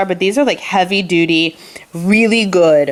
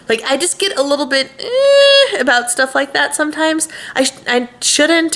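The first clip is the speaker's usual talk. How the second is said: higher, fighting back tears; emotional crack; 7 semitones higher than usual. Real, not acted. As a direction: voice breaking with worry. Worry about safety, voice cracks.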